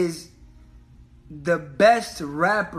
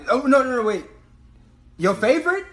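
loud opinionated ribbing; 6 semitones higher, 3 dB louder than usual. Real, not acted. Ribbing friend's top five pick.

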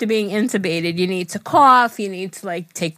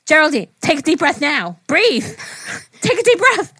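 urgent nurse; 8 semitones higher, 5 dB louder than usual. Retold or imitated.

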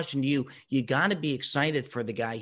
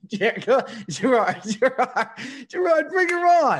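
high, loud, laughing urging; excited calling out; 10 semitones higher, 6 dB louder than usual. Real, not acted. Host begging guest to bring mom on, high and excited. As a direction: shout it high, giddy with laughter.

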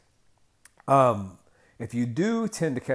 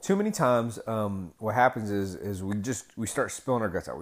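ordinary talk, nothing marked

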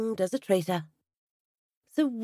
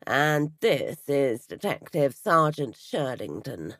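low detective voice; 7 semitones lower, slower than usual. A narrator's reading. Sergeant voiced low and slow.

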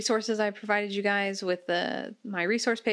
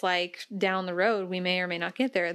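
usual speaking voice

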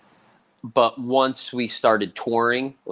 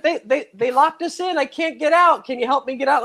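Bridget panicking. Retold or imitated. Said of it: "She's like".

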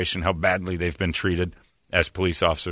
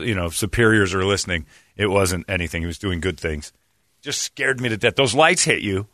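ordinary delivery

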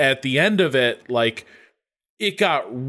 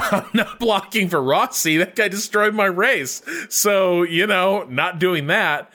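indignant refusal; 6 semitones higher than usual. Retold or imitated. Voices driver's refusal, laughing.

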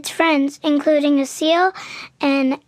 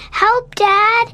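higher-pitched call for help; shouting; 6 semitones higher, 4 dB louder than usual. Acted. Boy sees the fin return and calls dad, shouting. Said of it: "he shouted".

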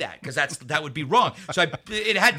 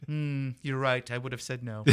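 mock resigned boss; 2 semitones lower, 4 dB quieter than usual. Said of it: "And he goes".

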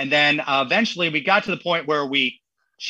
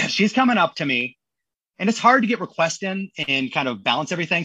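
usual speaking voice

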